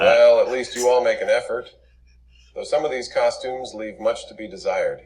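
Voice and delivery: loud, haughty critique; condescending. Acted. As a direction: look down on them loudly. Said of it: "he says".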